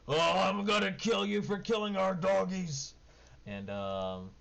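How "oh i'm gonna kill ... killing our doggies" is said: high, loud furious ogre threat; angry ogre. Acted. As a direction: roar it like an enraged ogre. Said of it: "the ogre ... says".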